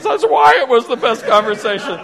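high, very loud emotional outburst; mock wail. Wife explains to husband why the talk was best, hammed-up high wail. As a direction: belt out the punchline as the overwrought wife. Crying wife's punchline to husband.

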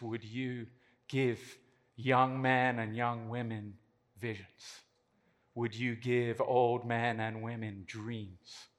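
soft, low, slow prayer voice; quiet prayer. Real, not acted. Speaker prays over the audience for young people, soft and hushed. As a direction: pray gently and unhurried, eyes closed.

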